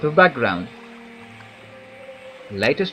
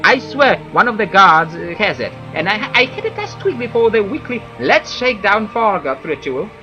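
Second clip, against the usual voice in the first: higher whiny villager voice; Fargoth; 6 semitones higher, 4 dB louder than usual. Acted. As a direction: voice a fussy whiny elf. NPC Fargoth dialogue voiced.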